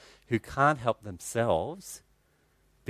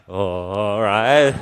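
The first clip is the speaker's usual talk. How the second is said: slow, drawn-out reluctant "oh, all right"; grudging reply; 7 dB louder, much slower than usual. Retold or imitated. Caught out by the man's glare, a slow grudging reluctant reply. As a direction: give in without meaning it.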